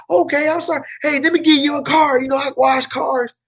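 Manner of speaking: high, eager Southern bumpkin; played-up Southern accent. Flyer guy offering his card, played-up Southern accent. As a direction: apologize brightly in a hammed-up high Southern accent. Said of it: "play up my Southern accent".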